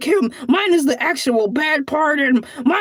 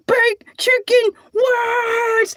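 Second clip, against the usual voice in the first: triumphant shout; 4 semitones higher, much slower than usual. Real, not acted. Repeated, slow triumphant shout.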